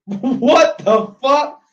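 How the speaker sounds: a laugh, then a loud, high, slow what the fuck; cracking up in disbelief. Real, not acted. Reacting to a girl who named London, laughing in disbelief.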